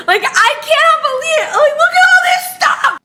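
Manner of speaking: very high, disbelieving excitement; overwhelmed squeal. Real, not acted. Streamer showing viewers a pile of gifts, overwhelmed squeal.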